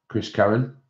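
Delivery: ordinary talk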